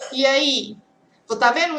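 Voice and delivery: ordinary talk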